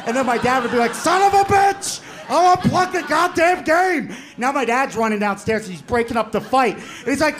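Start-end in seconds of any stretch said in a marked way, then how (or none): 0.9-4.1 very high, loud, stretched-out screaming; hammed-up parental rage; furious dad yell. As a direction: holler like a dad at his wits' end. Angry dad yelling at fighting sons.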